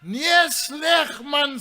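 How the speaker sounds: high, loud, hollered reply; shouting down. Acted. Man in the stand answers his friend below, shouting down. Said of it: "skree die man af van bo af".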